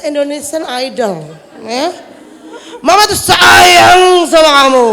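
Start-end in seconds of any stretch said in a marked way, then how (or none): 2.8-4.9 high, slow shout of "sayang", louder; raised-pitch shout. Shown as a demonstration. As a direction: yell the loving words at the child. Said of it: "Tapi pitch control-nya naik". (teriakan tinggi dan lambat "Mama tuh sayang sama kamu")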